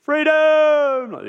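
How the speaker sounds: high, loud drawn-out yell; battle cry. Retold or imitated. Preacher imitating Wallace rallying the army, full battle cry. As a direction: roar it like a warrior rallying an army. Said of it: "Except he says".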